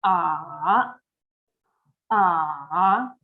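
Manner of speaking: 'Ah' dips low, then rises; third tone demo. Shown as a demonstration. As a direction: model the Mandarin third tone on 'Ah', falling then climbing. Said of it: "This is what it sounds like".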